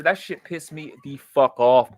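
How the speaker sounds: usual speaking voice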